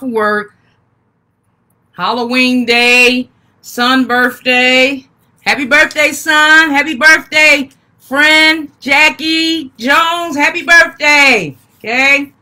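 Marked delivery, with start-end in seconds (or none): 1.9-12.3 raised, louder and higher, beaming greetings; cheery shout-out to son and friend on a live stream; excited birthday greetings. Real, not acted. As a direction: call out the birthday wishes with real excitement.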